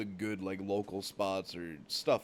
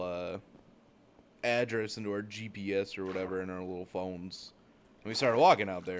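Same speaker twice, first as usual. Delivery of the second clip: ordinary delivery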